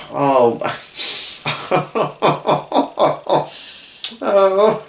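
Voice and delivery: real high-pitched sobbing breakdown; crying. Real, not acted. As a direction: break into sobs, then apologise through tears. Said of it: "I didn't mean to cry".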